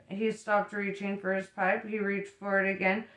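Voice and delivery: ordinary delivery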